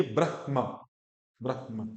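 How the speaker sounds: ब्रह्मा as "brah-ma", falling; breathed h. Shown as a demonstration. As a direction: puff the h in ब्रह्मा before ma. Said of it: "हा की ध्वनि भी आए".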